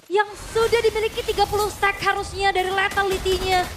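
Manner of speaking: fighting game announcer. Acted. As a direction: stretch each word like an arena announcer. Dramatic call after the teamfight.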